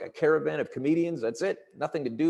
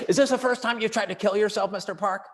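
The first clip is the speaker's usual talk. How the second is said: mock scream, pitched high; furious nurse; 7 semitones higher than usual. Intake nurse to patient on the gurney, mock furious screaming. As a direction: imitate a nurse's shrill-pitched angry accusation. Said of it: "just furious and screaming at me".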